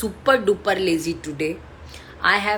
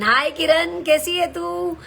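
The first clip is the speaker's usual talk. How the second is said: high-pitched, louder happy hello; excited greeting; 12 semitones higher, 4 dB louder than usual. Real, not acted. Aunt spots her niece in the live chat, excited. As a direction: greet family in a raised, delighted voice.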